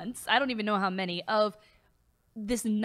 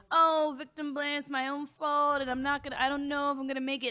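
self-pitying hero; 5 semitones higher than usual. Pretend. Fan parodies the hero's victim arc, mock self-pity. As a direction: wail lightly like a melodrama hero. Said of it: "they did that arc of".

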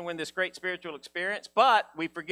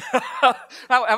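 laughing; 6 semitones higher, 7 dB louder than usual. Real, not acted. Laughing after joke.

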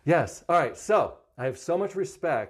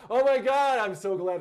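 excited; 9 semitones higher than usual. Real, not acted. Excited phone greeting.

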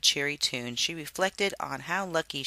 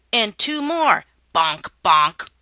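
triumphant shout; 7 semitones higher, 11 dB louder, slower than usual. Acted. Final strikes on snake.

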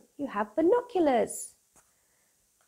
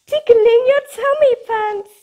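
tickly squeal; 13 semitones higher, 15 dB louder than usual. Acted. Tickling line in kids' book.

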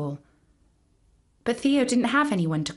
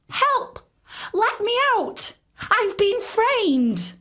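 screeching; 13 semitones higher, slower than usual. A narrator's reading. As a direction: shriek for help like a trapped monkey. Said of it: "Theo screeched".

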